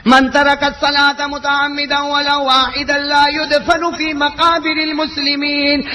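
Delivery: slow, measured Arabic quotation; Arabic recitation. Acted. Preacher reciting the saint's Arabic fatwa, slow chant-like. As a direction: intone the Arabic words deliberately.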